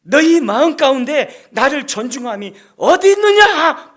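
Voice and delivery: loud, high rebuking shout; furious shouted rebuke as God. Acted. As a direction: hurl the question at them. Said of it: "막 책망한 거 아닙니까?".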